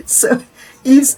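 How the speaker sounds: usual speaking voice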